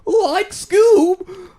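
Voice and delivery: very high, loud cartoon Shaggy. Shown as a demonstration. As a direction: squeak it like Shaggy calling Scooby. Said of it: "you did it then".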